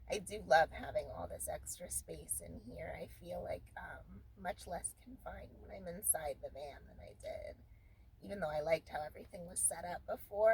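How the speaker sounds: soft early-morning whisper; hushed, keeping the voice down. Real, not acted. Quiet morning talk in van.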